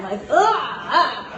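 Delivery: slow, drawn-out ugh of distaste; disgusted groan. Retold or imitated. Recalling the chalky candy aftertaste, slow disgusted groan. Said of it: "this chalky feeling".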